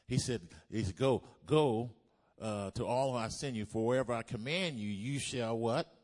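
very loud, driving scripture delivery; full-force preaching. Real, not acted. Preacher quotes God's command to the congregation, preaching at full force.

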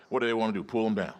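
normal talking voice

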